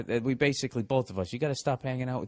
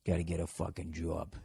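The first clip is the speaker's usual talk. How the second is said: low, soft Boston accent; muted Boston townie; 7 semitones lower, 9 dB quieter than usual. Shown as a demonstration. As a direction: demo Boston speech on "job". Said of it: "working on your Boston accents".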